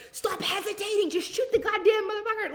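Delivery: high, voiced-over yell at a movie character; yelling at screen. Viewer yelling at a horror movie hero, high mock yell. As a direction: shout at the screen in frustration. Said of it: "you're like".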